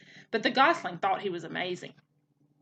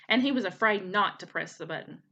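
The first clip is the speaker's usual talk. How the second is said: plain reading voice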